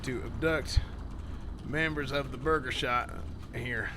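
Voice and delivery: calmly key the mic and report in; radio call. Acted. Sheriff reports a kidnapping at Burger Shot over radio, low report.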